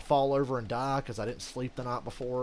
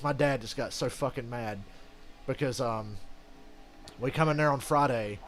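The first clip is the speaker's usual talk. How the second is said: ordinary talk, nothing marked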